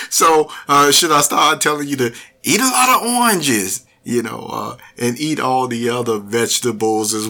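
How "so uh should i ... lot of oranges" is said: louder, higher, quick teasing question; amused. Real, not acted. Host joking with listeners about oranges, amused and fast. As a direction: tease the listeners while still amused. Joking after his own laugh.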